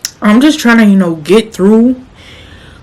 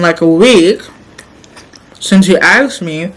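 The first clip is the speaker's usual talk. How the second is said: normal talking voice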